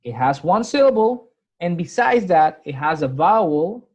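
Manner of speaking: ordinary delivery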